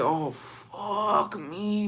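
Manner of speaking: very slow, hushed curse; dreading groan. Retold or imitated. Reacting to seeing grandma there, slow hushed dread. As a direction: drag it out under your breath in dread.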